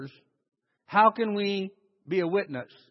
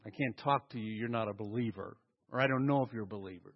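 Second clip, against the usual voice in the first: a hint of a prim separatist, softer and lower; mock snob; 9 semitones lower, 8 dB quieter, faster than usual. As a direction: lightly mimic a sanctimonious shopper.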